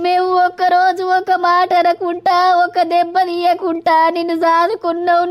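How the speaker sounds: ordinary delivery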